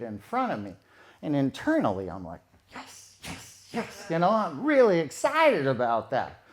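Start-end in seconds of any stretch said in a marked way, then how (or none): 2.7-4.1 breathless unvoiced whisper of glee; inner whispered yes. Man silently cheering inside as she undresses, whispered. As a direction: whisper it like a secret victory.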